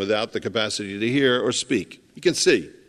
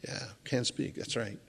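much softer, mumbling as a man who can't hear; mock deaf man; 14 dB quieter than usual. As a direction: quietly imitate the deaf man's confused sounds.

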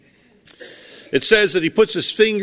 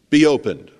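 be opened, spoken louder as a firm command; commanding call; 7 dB louder than usual. Retold or imitated. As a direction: command the body to open. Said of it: "Jesus says to the man's parts".

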